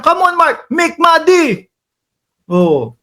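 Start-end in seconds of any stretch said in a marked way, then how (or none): 0.0-1.6 mock yell, pitched higher; hammed-up senator scolding him; imitating Bato yelling again. Host mimicking the senator yelling at him, mock yell. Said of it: "baka mamaya sigawan ako niyan".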